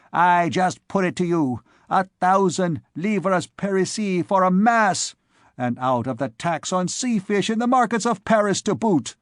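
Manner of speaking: high, loud grumbling fishwife; indignant old woman. Acted. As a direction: complain like an angry fishwife. Old woman complaining loudly.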